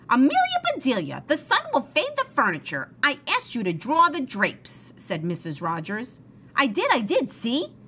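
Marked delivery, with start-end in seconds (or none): 0.1-4.9 high, emphatic scolding of the maid; upset employer's high-pitched reproach; exasperated scolding. A narrator's reading. Mrs. Rogers scolds her maid. 6.5-7.7 high, insistent self-defence; pitched-up, emphatic insistence; eager protest. A narrator's reading. Maid protests she obeyed.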